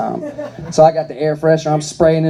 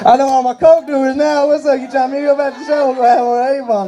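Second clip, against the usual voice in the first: mock dealer voice; 8 semitones higher than usual. Mock dealer phone talk.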